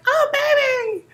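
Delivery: high-pitched mock gushing over a baby; exaggerated baby-belly squeal; excited cooing. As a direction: coo it high, imitating a pushy admirer. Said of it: "they are so excited".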